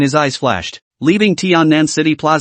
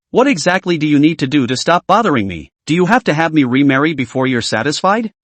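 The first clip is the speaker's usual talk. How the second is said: ordinary delivery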